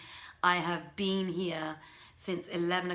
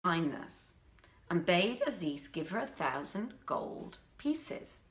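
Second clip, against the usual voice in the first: story narration; 6 semitones higher than usual. A narrator's reading. Narrator reading the Arabian Nights live to listeners, expressive storytelling. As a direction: read the old tale with expressive rise and fall.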